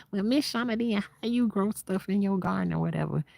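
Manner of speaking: imagined viewer question. Pretend. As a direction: ask it as a curious viewer. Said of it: "Child, that's where".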